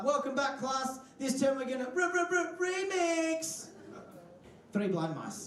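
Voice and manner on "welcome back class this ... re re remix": high, hammed-up teacher voice building to remix; teacher announcing. Teacher tells the class the new term song, mock hyped. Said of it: "Teacher's like".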